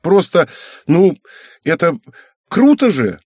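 put-on high, loud, halting girl; gushing teenage-girl exclamation; excited justification. A narrator's reading. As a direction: search for words, then burst out. Indignant daughter justifies herself excitedly.

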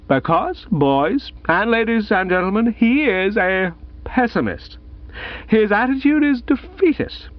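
high, tipsy orator holding forth; a drunk man lecturing schoolboys grandly; tipsy speechmaker. Acted. Gussie's drunken prize-day speech.

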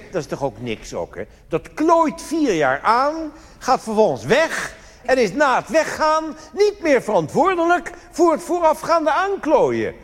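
high, loud, slowed-down rant. Real, not acted. Comedian rants to the audience about the cabinet, indignant. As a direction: hammer home the outrage.

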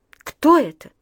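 frightened cry. Acted. Frightened woman asks about corpse.

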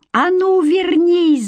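high slow loud shout; yelling after someone. Acted. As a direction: yell after the fleeing foe. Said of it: "закричала девушка". (громкий крик вдогонку)

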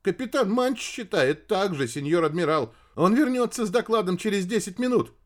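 high-pitched anxious subordinate; nervous radio operator. A narrator's reading. Ship's radio operator reporting to admiral, nervous. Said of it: "дрожащим голосом".

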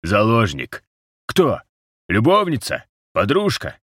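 high, punchy one-word questions; insistent, high-pitched interrogation; pressing guesses. A narrator's reading. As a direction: fire each guess as a separate sharp question. Rose fires guesses at the captive.

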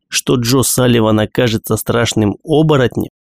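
ordinary delivery

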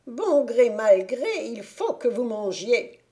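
loud, high witch voice forcing him to eat; angry witch. A narrator's reading. Witch to the prince, angrily ordering him to eat: loud, high fury. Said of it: "Elle le prit avec colère et dit".

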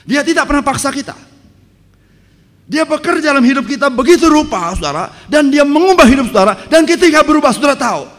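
preacher proclaiming that God changes us; preaching at full force. Acted. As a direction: proclaim it to the whole church. Preacher continues the climax.

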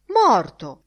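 loud, falling cry; exclaimed. A narrator's reading. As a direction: burst out with the word. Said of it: "esclamò Martin Cunningham".

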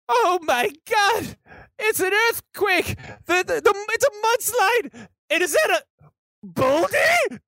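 very high, stammering panic; hammed-up high-pitched alarm; panicked shriek. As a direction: cry out in mounting terror at disaster. Guide sees the mudslide coming.

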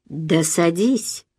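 quiet, drawn-out urging; low insistence. A narrator's reading. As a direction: insist softly and very slowly. Curt man insists she sit. (тихо, медленно настаивая)

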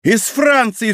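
high-pitched eager cry; excited exclamation. A narrator's reading. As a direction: cry out, forgetting everything else. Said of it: "вскричал Бекингем, забывая обо всём".

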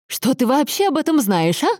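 high, indignant challenge; flaring up. A narrator's reading. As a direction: lose your temper and challenge him. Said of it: "вспылила Сальватора".

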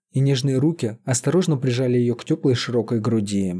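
usual speaking voice

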